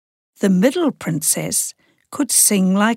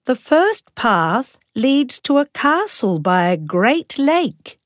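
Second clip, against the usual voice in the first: excited princess; 6 semitones higher than usual. A narrator's reading. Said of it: "she said".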